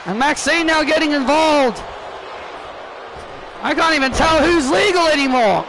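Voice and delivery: excited play-by-play. Acted. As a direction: call the big move like a hyped commentator.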